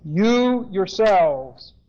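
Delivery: "You" stretched long and stressed, then "yourselves" drawn out; emphatic stress on You. Shown as a demonstration. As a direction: lean hard and slowly on "You". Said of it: "Now this is emphatic".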